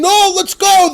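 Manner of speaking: high, loud eager cry; eager excitement. Retold or imitated. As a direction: burst out ready to march. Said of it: "They're eager, they're excited".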